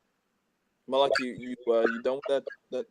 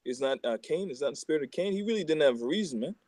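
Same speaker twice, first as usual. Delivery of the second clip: usual speaking voice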